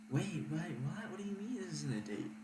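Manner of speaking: high-pitched mock bewilderment; incredulous girl impression, pitched well up; imitating the date's disbelief.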